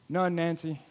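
soft, low sheepish answer; husband owning up to his wife; sheepish mumble. Retold or imitated. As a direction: admit it quietly, embarrassed.

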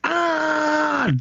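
high, sudden mock yell; retold yell. Thurman yelling before kicking the kid, a high mock yell. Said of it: "Thurman just goes".

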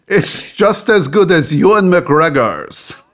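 high, slow mock French accent; hammed-up French-accented game show host; bad French accent. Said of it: "my bad French accent".

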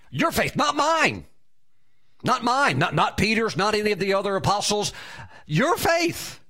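"your faith" lifted high, "not mine" hammered; preacher driving home whose faith it was; emphatic stress on faith. Real, not acted. Preacher insists to congregation: "your faith," said high and emphatic.

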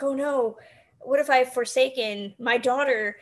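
ordinary talk

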